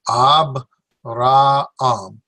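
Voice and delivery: "Abraham" very slowly as AHB... rah... AHM; syllable by syllable. Shown as a demonstration. Teacher separating two vowels, "Abraham" slowly in separate beats. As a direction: draw out "Abraham" in pieces, separating the two a's. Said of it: "You separate these two here".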